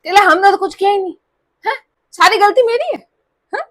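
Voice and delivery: high, half-crying self-pity, hammed up; mock whiny sulk. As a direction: imitate a sulking tailor playing the victim. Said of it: "मुंह उतर गया, मुंह एकदम ऐसे".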